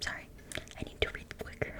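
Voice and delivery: ordinary delivery, nothing marked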